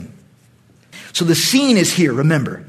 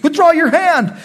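Saul's command; 10 semitones higher, 4 dB louder than usual. Acted. Saul commands the priest.

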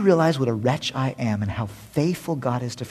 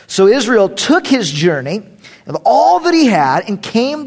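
loud, raised formal reading; preacher reading Genesis aloud; scripture reading; 3 semitones higher, 6 dB louder than usual. Acted. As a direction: proclaim the passage to the congregation.

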